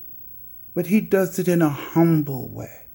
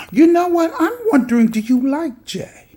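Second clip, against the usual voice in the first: teasing sing-song; 9 semitones higher, 4 dB louder than usual. Real, not acted. Teasing a rival on camera.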